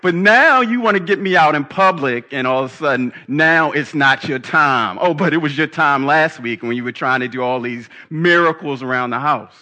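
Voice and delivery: loud, sarcastic mother telling off her son; scolding mom, heavy sarcasm at full voice; Mary. Acted. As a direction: throw his excuse back at him, loudly. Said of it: "I can imagine Mary turning to her son".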